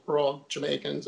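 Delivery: usual speaking voice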